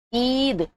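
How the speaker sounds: عید with a long, clear "ee"; drawn-out vowel. Shown as a demonstration. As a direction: stretch the ی in عید. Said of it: "خوب کھینچ کر پڑھی جا رہی ہے". (عید کو کھینچ کر)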